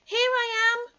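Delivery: a tiny joey showing off; squeaky Roo. Acted. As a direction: Roo calling from the water, squeaky and proud. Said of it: "squeaked Roo".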